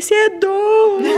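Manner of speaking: high-pitched mock wail through laughter; hammed-up sobbing protest; mock crying. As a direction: imitate your own tearful outburst, half laughing. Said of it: "eu chorando, chorando, chorando".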